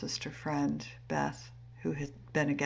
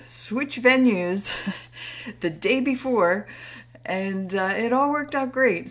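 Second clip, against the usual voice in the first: laughing; 6 semitones higher, 8 dB louder than usual. Real, not acted. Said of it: "as I'm laughing and smiling".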